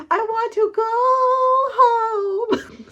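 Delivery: hoog, gespeeld jammerend huilen; mock wailing. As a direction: jank het als een overdreven huilbaby. Reader's wailing reaction to scene.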